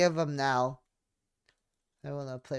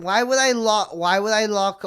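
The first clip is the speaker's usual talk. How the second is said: loud, high disbelieving yell; shocked outburst; 7 semitones higher, 11 dB louder than usual. Real, not acted. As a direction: shout it in disbelief at the twist. Shocked by twist in game.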